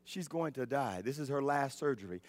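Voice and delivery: low, soft voice of the Lord; grave, hushed news. Retold or imitated. The Lord telling a son about his mother, grave and hushed. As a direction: break it gently, low and quiet.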